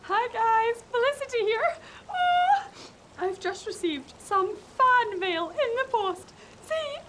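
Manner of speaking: high, excited cartoon girl voice; very high and chirpy; bubbly puppet girl. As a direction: gush at the fans like a squeaky puppet. Puppet character Felicity greets fans.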